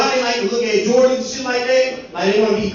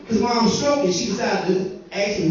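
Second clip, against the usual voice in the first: ordinary delivery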